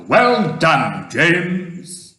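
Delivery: loud congratulating cheer; shouting. A narrator's reading. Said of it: "shouted the old green grasshopper".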